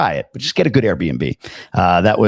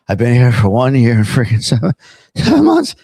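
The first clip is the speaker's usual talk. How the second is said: mock exasperation; 3 dB louder than usual. Imagines a viewer counting months wearily.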